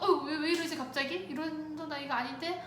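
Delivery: lightly re-enacted bewildered parent; baffled. Mother facing sudden tantrum in the market, baffled.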